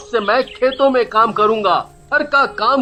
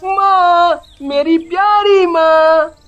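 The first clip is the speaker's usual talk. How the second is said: high, drawn-out loving cry; little boy calling to his dear mother, slowly and happily; overjoyed child; 11 semitones higher, 6 dB louder, much slower than usual. Acted. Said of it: "बहुत खुश होता है". (खुश बच्चे की ऊँची, लंबी पुकार)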